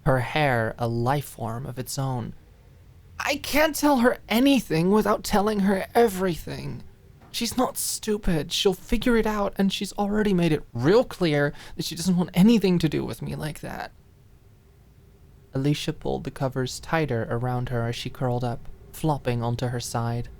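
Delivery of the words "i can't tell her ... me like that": raw, teary. Acted. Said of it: "voice raw from a mixture of crying".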